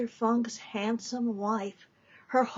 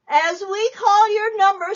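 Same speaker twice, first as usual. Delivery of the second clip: loud and much higher; nun announcing; 9 semitones higher, 9 dB louder than usual. Acted. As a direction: address the whole depot.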